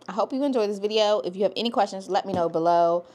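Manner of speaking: usual speaking voice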